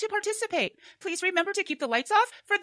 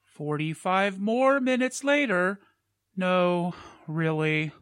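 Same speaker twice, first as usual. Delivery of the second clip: deadpan narrator; 8 semitones lower, much slower than usual. Acted. Narrator time-skip caption.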